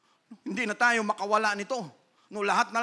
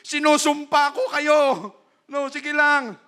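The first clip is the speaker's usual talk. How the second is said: higher, louder vengeful taunt; vengeful threat; 6 semitones higher, 6 dB louder than usual. Acted. Preacher acts a bitter Joseph threatening his brothers, with loud vengeful anger. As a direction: threaten them, promising payback. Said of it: "habang andun ka sa karwahe".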